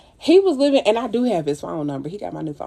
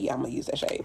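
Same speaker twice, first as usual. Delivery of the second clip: whispered, much softer note to self; whispered aside; 7 semitones lower, 10 dB quieter, much faster than usual. Real, not acted. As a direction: whisper it to yourself mid-task. Aside to herself on makeup.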